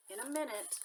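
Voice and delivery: usual speaking voice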